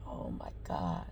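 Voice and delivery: breathy stunned whisper of disbelief; shocked whisper. Retold or imitated. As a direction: whisper it as if the news just knocked you back. Shocked reaction to the dog news.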